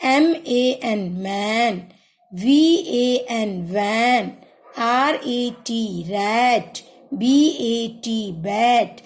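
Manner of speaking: slow spelled letters, then the word blended; teacher leading a class in repeating after her; slow phonics drill. Shown as a demonstration. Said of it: "अब मैं इसको पढ़ रही हूँ बेटा, इसके साथ साथ आप लोग भी इसको पढ़िए".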